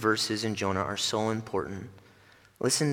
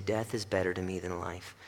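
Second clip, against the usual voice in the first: soft, low, weary despair; mock despair; 3 semitones lower, 7 dB quieter than usual. Preacher voices Jonah's despair, soft and weary. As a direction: sigh out the wish to die.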